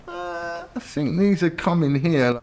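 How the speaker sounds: high, nervous squeak at the police car; mock panic. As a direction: pitch up in a jittery mock panic.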